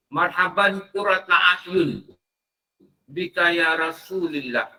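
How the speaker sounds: slow chanted Arabic prayer; recited prayer. Retold or imitated. Preacher repeats the friend's prayer over the eyes, slow chant. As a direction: intone the blessing slowly. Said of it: "Dia kata ini adalah sunnah dia".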